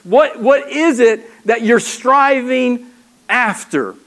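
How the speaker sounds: normal talking voice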